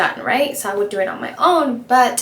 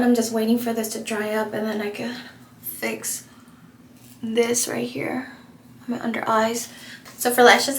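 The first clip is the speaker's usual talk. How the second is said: ordinary talk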